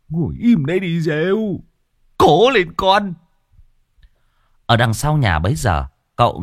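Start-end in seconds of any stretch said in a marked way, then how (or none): 0.1-3.1 put-on high, coaxing voice of an old relative; pleading elder. A narrator's reading. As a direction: beg him to hold on. Elder comforting tied-up man. (người già dỗ dành, giọng cao)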